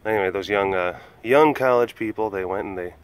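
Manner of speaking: ordinary talk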